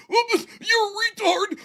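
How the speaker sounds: high, slow, fake-sobbing imitation; mock whiny caller. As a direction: imitate him as a crying brat. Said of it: "As he".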